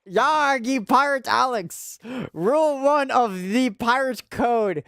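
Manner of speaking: mock pirate voice reciting the pirate code; pirate accent. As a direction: drawl it like a cartoon pirate captain laying down the code. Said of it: "I really wanted us to do pirate accent".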